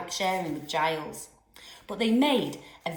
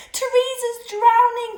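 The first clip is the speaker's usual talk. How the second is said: much higher, louder sobbing wail; sobbed; 10 dB louder than usual. A narrator's reading. Kelly crying about her drowning doll, sobbing. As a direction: wail it in distress. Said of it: "Kelly sobbed".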